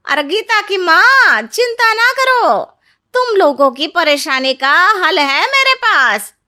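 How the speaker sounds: high, loud, showy auntie voice; busybody neighbour auntie. Acted. Neighbour auntie bursting in on worried parents, loud and eager. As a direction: sweep in like the nosy neighbour with big news. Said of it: "तभी पास की मौसी उन लोगों के घर आती है".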